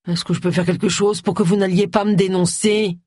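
mournful, imploring question; gloomy pleading. A narrator's reading. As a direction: plead dolefully in a put-on voice. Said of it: "implorer Agatha d'un ton lugubre".

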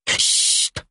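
long unvoiced shush; hissed protest for silence. A narrator's reading. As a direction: shush someone in the audience. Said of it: "protesta une voix derrière elle".

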